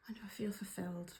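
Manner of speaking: soft, fast whisper to herself; whispered musing. Real, not acted. Turning the card's question on herself, whispering quickly. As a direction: mull it over under your breath.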